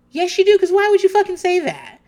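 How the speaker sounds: high, loud, incredulous call-out; exasperated rebuttal. Acted. Host calling out Anna's denial, exasperated. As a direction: talk back to her in disbelief, pitch shooting up.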